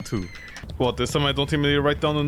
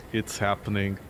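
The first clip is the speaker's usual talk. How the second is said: deeper, softer doom whisper; quieter ominous murmur; 10 semitones lower, 5 dB quieter than usual. Pretend. Streamer sinking further into mock dread. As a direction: let it sink lower. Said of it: "repeated dramatic line".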